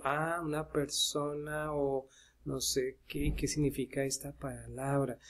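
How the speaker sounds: estudiante que piensa en voz baja, despacio; piénsalo para ti, bajito y dudando; estudiante pensando. Acted. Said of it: "Entonces de pronto digo". (estudiante dudando en voz baja)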